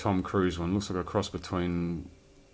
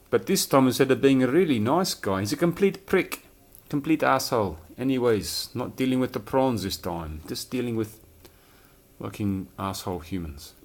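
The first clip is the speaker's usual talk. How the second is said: put-on higher, louder South African accent; 4 semitones higher, 4 dB louder than usual. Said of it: "Sorry about my South African accent".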